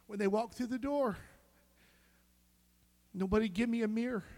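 retold panic. Retelling panicked plea in hospital.

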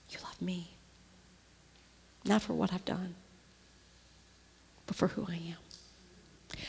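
soft, hushed self-talk into a whisper; whispered to herself. Retold or imitated. Woman whispering to herself before the mirror, softly. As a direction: murmur it to yourself in the mirror. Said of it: "I look in the mirror and I say".

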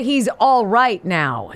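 he's all right now, spoken slowly with weight on all; slow, drawn-out delivery. Shown as a demonstration. Co-host showing how to deliver the punchline, slow and deliberate. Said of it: "it's the way you deliver it".

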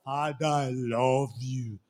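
low, slow, stiff imitation; mock a stiffly formal prayer; solemn and measured, deliberately distant; too formal. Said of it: "Some of you, you are too formal".

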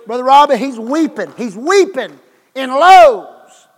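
a loud, slowed preaching cry; preaching emphasis. Acted.

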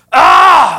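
loud, high imitated child's scream; frustrated scream. Preacher mimicking his frustrated daughter, screaming. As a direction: scream out like a really frustrated kid. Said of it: "like, screaming".

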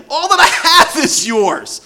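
overflowing generosity from a delighted father; father laughing as he offers everything; joyful father. Acted.

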